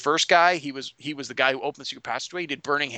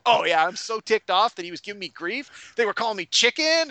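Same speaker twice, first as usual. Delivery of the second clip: higher-pitched, worked-up grievance; ticked-off Slayer; 7 semitones higher than usual. Retold or imitated. Slayer justifying a kill to the game master, ticked off. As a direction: build up the indignation, rising higher. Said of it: "And she's like".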